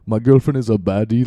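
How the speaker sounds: mock African accent. Repeating the show line for listeners, light mock African accent. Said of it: "like, in an African voice".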